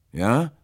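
high, loud rising "Ja"; prompting question. A narrator's reading. Will prompts the halting stranger, "Ja" rising loudly. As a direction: lift "Ja" sharply into a question. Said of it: "als die Stimme stockte".

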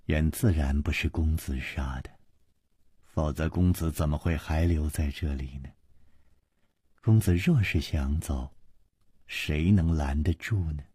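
low, hushed reassurance; soft, gentle. A narrator's reading. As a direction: soothe tenderly in a small, low voice. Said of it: "王妃柔声说". (柔声低语，温婉安抚)

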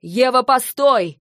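high, loud, slow call; calling after. Acted. Lentar running after Eva, loud call. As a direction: call out to stop her.

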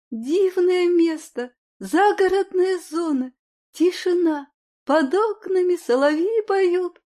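slow, dreamy and blissful. Acted. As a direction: savor every word dreamily. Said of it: "блаженно закатывая глаза".

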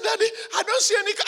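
fast, high, laughing dismissal; high quick laughing brush-off; girl dismissive. Retold or imitated. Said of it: "He said".

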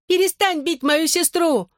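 high, drawn-out cry of protest; desperate shouted demand. A narrator's reading. Brother defends his beaten sister, high desperate cry. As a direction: cry out slowly, pitch far up.

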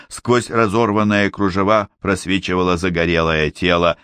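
plain reading voice

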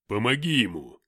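loud command from a towering power; forceful demand. A narrator's reading. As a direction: order it with booming force. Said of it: "потребовал он".

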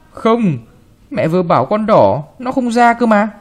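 put-on sulking boy arguing back; sulky child. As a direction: pout it out like a spoiled child. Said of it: "Thấy anh phụng phịu".

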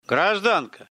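barking. A narrator's reading. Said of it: "рявкнул Сумков".

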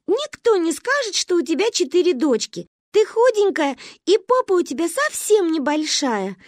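put-on high-pitched gushing child; admiring little girl. A narrator's reading. Daughter flattering her mother, admiring gush. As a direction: pile on compliments in a child's high voice.

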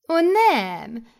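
loud, high protest; mother superior protesting. Acted. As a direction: brush their objection aside. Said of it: "mondta a főnöknő".

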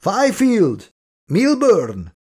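high-pitched shout of names; calling out. A narrator's reading. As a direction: call out to missing crewmates. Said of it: "már el is kiáltotta magát". (magas hangú kiáltás)

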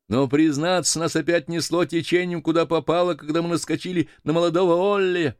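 high-pitched sailor's hail; voice from schooner. Acted. (высокий голос моряка со шхуны)

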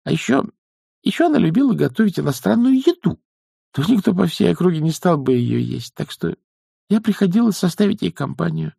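put-on high, girlish character voice; self-justifying young witch. Young witch explains visits to her elders, high and halting.

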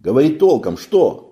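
loud, high demanding; loud sailor. A narrator's reading. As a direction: press him for an answer.

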